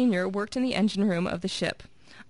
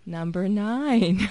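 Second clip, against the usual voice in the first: very slow stretched words; slow drawn-out; 4 dB louder, much slower than usual. Acted. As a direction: drag it out to stall. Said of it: "Are we talking slow to stretch?".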